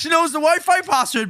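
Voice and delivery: high, loud incredulous cry; amazed outburst. Real, not acted. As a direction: shout it in sheer disbelief. Amazed AI knows the password.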